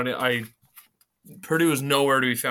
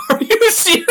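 high squeal of laughter; laughing disbelief; 9 dB louder than usual. Real, not acted. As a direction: laugh it out in shock. Same spread number, disbelief.

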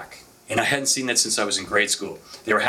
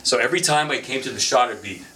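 normal talking voice

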